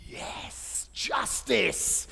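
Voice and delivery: whispered yes, then a hushed justice; a hint of mock triumph; triumphant whisper. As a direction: savour a tiny victory under your breath. Triumphant at getting reward points.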